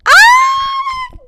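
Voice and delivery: very high, loud squeal; piercing scream of delight; fangirl scream. Real, not acted. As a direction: shriek over a crush. Screams over book crush talking.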